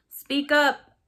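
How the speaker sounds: high, loud call from the back; yelled heckle. A narrator's reading. Someone in the press crowd heckling the speaker, yelled. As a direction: shout it out over the crowd. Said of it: "someone yelled in the background".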